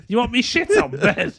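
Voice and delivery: high, incredulous squawk; exaggerated disbelief, pitched right up; mock appalled. Guest told to do it on the bed, mock appalled.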